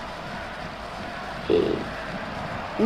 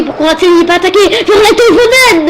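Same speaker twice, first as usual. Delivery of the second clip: rising, louder play-by-play with a laugh; excited commentary; 9 semitones higher, 8 dB louder than usual. Acted. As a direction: get swept up as the chance builds, high and loud. Commentating a chance in match.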